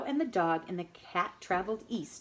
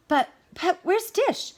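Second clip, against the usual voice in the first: high, stammering worry; anxious stammer; worried; 11 semitones higher, 3 dB louder than usual. A narrator's reading. Spoon missing dish.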